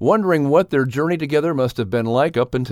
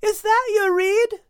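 put-on curious girl dog. A narrator's reading. Kate asks Max about his reed, high and curious. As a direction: ask it wide-eyed and sweet.

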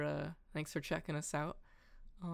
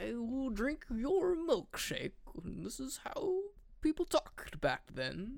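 slow, much higher, put-on old-timey tycoon voice; old-timey businessman; 11 semitones higher, slower than usual. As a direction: ham up an eighteen hundreds businessman. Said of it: "talked like an old-timey, like, eighteen hundreds businessman".

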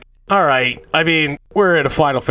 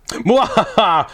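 mock villain laugh; 8 semitones higher, slower than usual.

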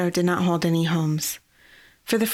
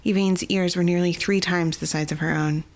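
ordinary delivery, nothing marked

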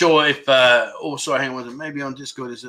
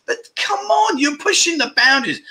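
mock exasperation; 10 semitones higher than usual. Real, not acted. Teasing viewer's big dream.

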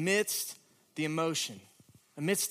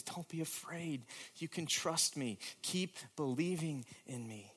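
much softer, calm comforting voice; Jesus tenderly telling Jairus not to fear; tender reassurance; 7 dB quieter than usual. Acted. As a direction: quietly comfort a frightened father.

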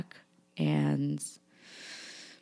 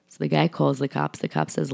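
usual speaking voice

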